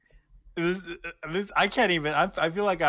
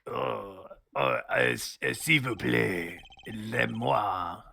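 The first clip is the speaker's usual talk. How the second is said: put-on light French accent; 4 semitones lower, slower than usual. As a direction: say it like a French character. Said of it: "in Clavier's accent".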